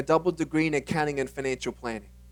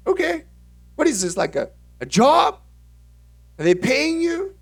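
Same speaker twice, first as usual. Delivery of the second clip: interrogating mom; 12 semitones higher, 4 dB louder than usual. Retold or imitated.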